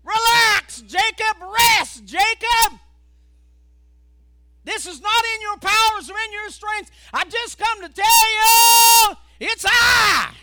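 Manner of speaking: loud, high call of God. Acted. God calling to weary Jacob, loud and high. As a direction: shout God's comfort to Jacob.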